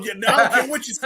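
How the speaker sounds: high-pitched, badly faked Australian accent; hammed-up imitation of a bossy Australian wife. Coaching friend to overrule her husband, bad Australian accent. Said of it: "that was the worst Australian accent ever".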